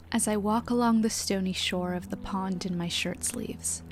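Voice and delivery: calm, low reading voice; measured audiobook reading; literary narration. Acted. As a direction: read it softly and evenly, like a reflective journal. Reads Walden aloud.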